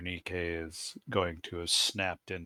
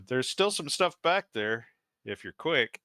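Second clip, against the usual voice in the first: raised, louder voice with lifted pitch; louder, higher warning to rivals; calling out in character; 5 semitones higher, 5 dB louder than usual. Acted. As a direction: call it across a standoff to the other side. Calls out Berenike's line at standoff.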